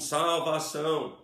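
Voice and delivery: ordinary talk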